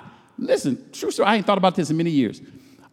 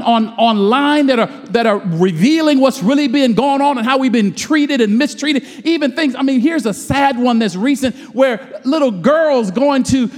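higher, slow, drawn-out preaching cadence; full-force preaching; 5 semitones higher than usual. Real, not acted. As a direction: preach it, building to the call.

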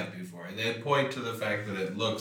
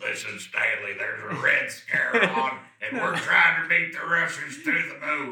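louder, higher general impression; army general voice; 7 semitones higher, 6 dB louder than usual. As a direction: lay it on like a general. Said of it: "Army general voice".

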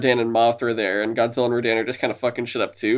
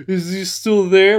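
higher, slow, wary question; timid peeking monster; 6 semitones higher, 3 dB louder, slower than usual. Retold or imitated. Rodan hiding behind a rock, higher slow wary question. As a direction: peek out nervously and ask.

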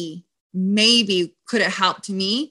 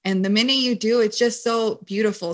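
ordinary talk

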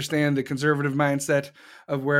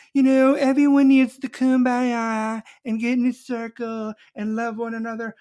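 mocking kumbaya; 6 semitones higher, 3 dB louder than usual.

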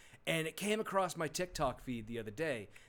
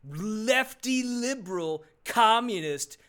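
mock news anchor; 5 semitones higher, 10 dB louder than usual. Mocking a Fox News segment.

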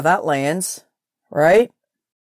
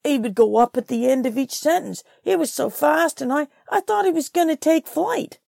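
rising higher and higher; 6 semitones higher than usual. Retold or imitated. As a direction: start at usual pitch, climb steadily higher. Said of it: "His voice would actually get higher and higher".

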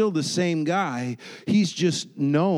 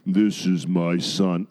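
booming voice; 9 semitones lower, slower than usual. Acted. Preacher voices God at the baptism, booming and deep. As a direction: boom it out low and slowly. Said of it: "you hear this booming voice".

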